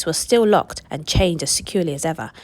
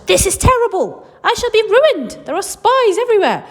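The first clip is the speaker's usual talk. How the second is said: high, loud frantic cry; Willy Wonka shouting in despair, high and loud; panicked shout; 11 semitones higher, 6 dB louder than usual. Acted. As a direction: wail it like a ruined man. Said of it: "tore his beard and shouted".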